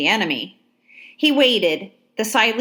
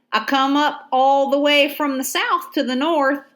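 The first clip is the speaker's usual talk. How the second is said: higher, twangy Georgia soldier voice; Georgia drawl; 6 semitones higher than usual. A narrator's reading. Soldier tells Ladysmith about his march, Georgia drawl. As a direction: tell it plain country-style. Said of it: "a Georgia accent".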